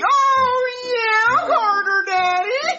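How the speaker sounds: very high, squealed fake moan; mock moaning.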